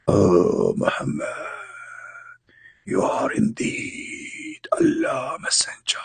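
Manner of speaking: faint, drawn-out ghostly call; hushed, eerie angel calling from afar; angel apparition voice. Acted. Jibreel appearing before Muhammad on the mountain, ghostly whispered call.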